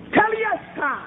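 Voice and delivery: high, called-out single word; triumphant cry. Retold or imitated. Cries out Christ's last word.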